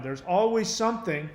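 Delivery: usual speaking voice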